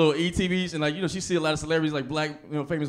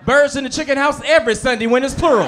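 louder mom voice laughing through it; hammy church-mom fake cursing; mom's curse substitute; 8 semitones higher, 8 dB louder than usual. Mom cleaning up her cursing, louder and laughing. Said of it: "she'd be like".

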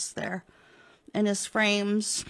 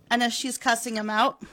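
ordinary talk

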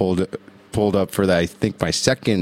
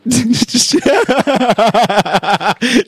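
loud high burst of real laughter; big laugh; 6 dB louder than usual. Real, not acted. Host cracking up on air at his co-host's joke, loud high laugh.

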